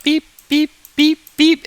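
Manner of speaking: high, evenly spaced beeps; high-pitched electronic sound effect; imitated beeps. Shown as a demonstration. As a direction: beep like LEDs lighting in turn. Imitates LEDs lighting in series.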